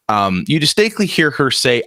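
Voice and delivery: ordinary talk, nothing marked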